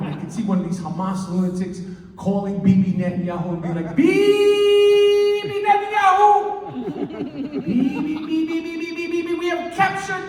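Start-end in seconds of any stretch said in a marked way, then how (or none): 3.9-10.2 much higher, slow sing-song yelling of Bibi's name; taunting captor call. Acted. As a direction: chant the name like a gloating playground taunt. Captor phoning Bibi, singing his name.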